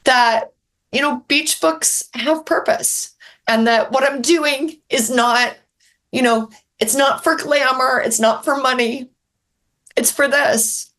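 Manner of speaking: pushing through real tears; welling up while explaining why she writes; emotional. Real, not acted. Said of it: "I'm getting emotional".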